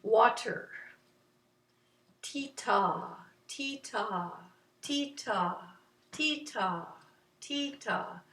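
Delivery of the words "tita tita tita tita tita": short ti, longer falling ta; steady two-beat chant; rhythm syllables. Shown as a demonstration. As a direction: repeat 'tita' evenly with gaps. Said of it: "How about water?".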